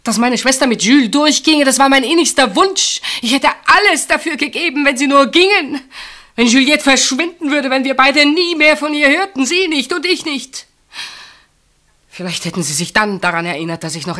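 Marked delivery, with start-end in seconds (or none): 0.0-10.7 high, loud, desperate pouring-out; desperate outburst. Acted. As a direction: blurt the secret wish out at full voice. Desperate confession of a wish. (verzweifelter, lauter Ausbruch)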